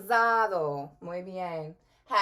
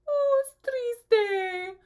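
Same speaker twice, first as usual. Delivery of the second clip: much higher, louder fake sob; hammed-up weepy voice; mock crying; 14 semitones higher, 6 dB louder, much slower than usual. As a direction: whimper it like a pretend-crying child. Says sad word crying.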